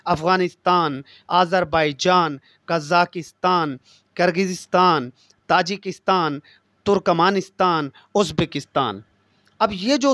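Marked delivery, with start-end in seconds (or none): 0.1-9.0 each country name spaced out slowly for students; slow dictation. Shown as a demonstration. Teacher dictating the country list to students, slow and clear. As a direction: spell the list out slowly, one name at a time. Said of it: "जान तान वाले शुरू होंगे".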